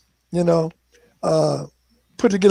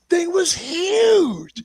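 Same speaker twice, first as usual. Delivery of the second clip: amazed; 9 semitones higher, much slower than usual. Retold or imitated. Amazed at the log cabin inside.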